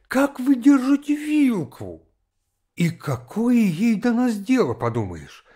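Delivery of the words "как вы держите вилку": very high, slow mock scolding; nagging governess. A narrator's reading. Governess correcting a child's table manners, high nagging voice. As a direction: draw out a high-pitched governess's reproof.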